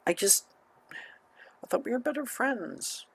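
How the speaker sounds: high, soft, slow and hurt; hurt girl voice. Acted. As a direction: let her sound let down and quiet.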